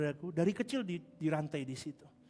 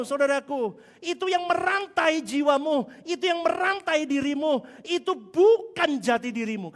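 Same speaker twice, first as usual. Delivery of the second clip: loud, high, impassioned sermon climax; fiery pulpit exhortation; full-force preaching; 10 semitones higher, 8 dB louder than usual. Acted. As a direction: preach it out, louder and higher.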